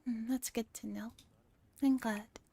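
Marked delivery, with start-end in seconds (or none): none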